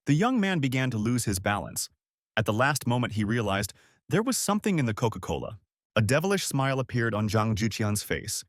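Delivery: ordinary delivery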